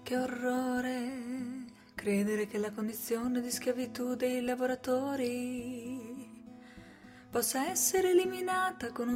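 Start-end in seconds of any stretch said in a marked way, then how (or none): none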